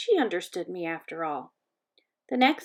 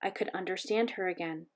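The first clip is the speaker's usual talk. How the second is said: ordinary delivery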